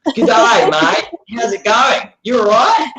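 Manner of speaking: loud, high mock Australian greeting; Australian accent. Playing up being mistaken for Australians, hammed-up Australian accent. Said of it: "maybe they sound Australian".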